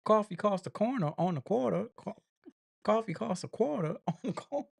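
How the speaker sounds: high, sing-song New York accent. Shown as a demonstration. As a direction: put on an exaggerated New Yorker voice. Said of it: "read it with my New York accent".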